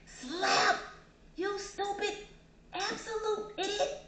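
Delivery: loud high slap, then drawn-out insult; angry insults. Acted. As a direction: act out a high-pitched furious attack.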